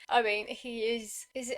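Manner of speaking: ordinary talk, nothing marked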